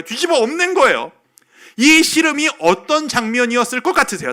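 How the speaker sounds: high, loud, driving sermon delivery; impassioned preaching. Real, not acted. Preacher telling how the angel wrestled Jacob all night, impassioned preaching. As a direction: drive the story home to the congregation.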